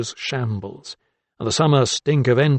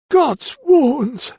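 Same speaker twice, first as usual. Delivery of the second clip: high, loud startled exclamation; old man crying out in alarm; shocked cry; 5 dB louder than usual. A narrator's reading. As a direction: blurt it out in shock. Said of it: "cried Doctor Carruthers".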